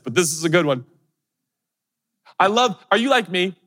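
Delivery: normal talking voice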